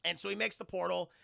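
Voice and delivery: normal talking voice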